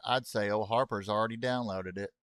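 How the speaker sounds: usual speaking voice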